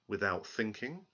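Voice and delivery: usual speaking voice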